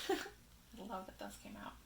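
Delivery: whispered softly to yourself. Shown as a demonstration. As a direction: breathe it out as a private aside.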